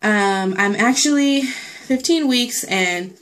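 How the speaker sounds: ordinary talk, nothing marked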